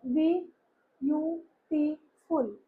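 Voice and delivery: slow "बी... यू... टी... फुल" with gaps; teacher sounding out "बी यू टी फुल" slowly; syllable by syllable. Shown as a demonstration. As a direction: break "बी यू टी फुल" into separate beats. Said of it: "अब हम इसको pronounce करते हैं".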